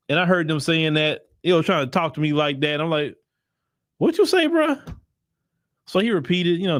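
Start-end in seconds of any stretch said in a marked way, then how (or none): 4.0-4.8 recreate the shocked double take; retold indignant surprise; incredulous challenge. Retelling reaction to South African.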